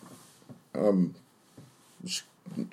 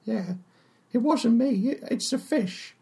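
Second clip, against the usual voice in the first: higher chatty tavern barmaid. Acted. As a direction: play the friendly, simple barmaid. GM voices Tallulah the barmaid.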